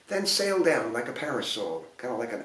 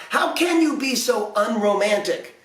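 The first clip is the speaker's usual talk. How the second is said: higher, frantic fairy voice; pleading, pitched up; frantic fairies; 7 semitones higher than usual. A narrator's reading. Fairies round the prince, high frantic plea. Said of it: "feeling frantic".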